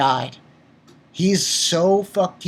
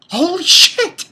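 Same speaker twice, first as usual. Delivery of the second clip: shocked exclamation; 12 semitones higher, 6 dB louder than usual. Real, not acted. Shocked by the character's backstory.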